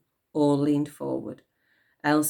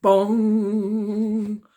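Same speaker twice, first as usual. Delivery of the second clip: voiced bong like a big clock bell; 5 semitones higher, 6 dB louder than usual. A narrator's reading. Narrator voicing Big Ben striking, bell bong.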